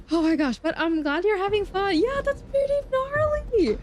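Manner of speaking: high, loud squeal; very high, excited reaction; excited agreement. Real, not acted. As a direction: climb into a squealed exclamation. Reacting to viewer's chat.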